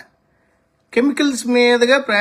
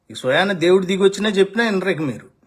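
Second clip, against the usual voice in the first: ordinary delivery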